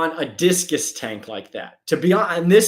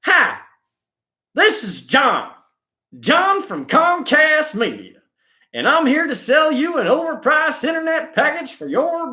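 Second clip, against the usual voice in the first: much higher, chirpy sales call voice; cold-call like a cheesy telemarketer; 10 semitones higher than usual. Retold or imitated. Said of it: "between that and".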